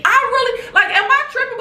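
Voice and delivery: laughing at her own irritation; incredulous. Real, not acted. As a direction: ask yourself in disbelief. Incredulous self-question.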